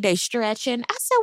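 normal talking voice

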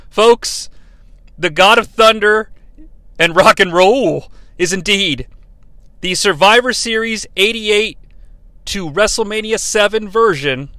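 loud, rising ring announcer hype; hype announcer build-up. Real, not acted. Hyped announcer-style reveal.

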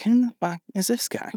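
high, disbelieving exclamation; incredulous. Retold or imitated. Spots a cool stranger, incredulous.

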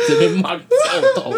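high, loud laughing burst. Real, not acted. Describing sister getting scolded, loud laughing burst. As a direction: shout it out through laughter.

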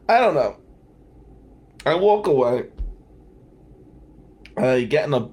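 normal talking voice

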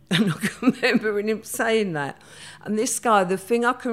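laughing through the line at the memory. Real, not acted. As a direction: chuckle while remembering it. Laughing while recalling his remark.

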